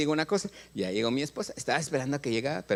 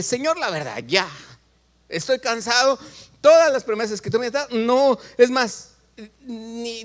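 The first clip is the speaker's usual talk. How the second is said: retold surrender prayer; 6 semitones higher than usual. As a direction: pour it out to God, tired of waiting. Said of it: "Y un día yo dije". (oración de entrega, más aguda y fuerte)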